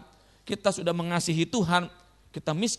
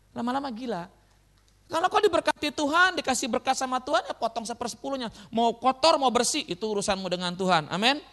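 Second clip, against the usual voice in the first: ordinary delivery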